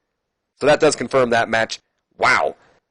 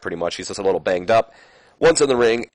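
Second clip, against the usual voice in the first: ordinary talk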